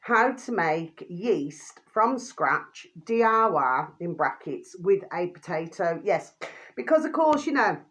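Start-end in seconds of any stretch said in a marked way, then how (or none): 0.0-6.0 slow, spelled-out title reading; slow dictation. Shown as a demonstration. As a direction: read it out slowly for viewers. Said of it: "I'm just going to repeat that again for you".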